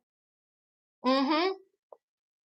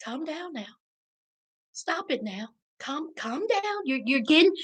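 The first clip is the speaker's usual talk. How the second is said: hushed, much softer mock soothing; imitating a husband shushing; 2 semitones lower, 10 dB quieter than usual. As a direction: mimic him quietly telling her to calm down. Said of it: "This is what he said".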